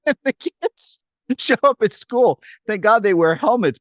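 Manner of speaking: laughing high while telling it. Real, not acted. Parent telling the host the story, laughing. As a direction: giggle through the line.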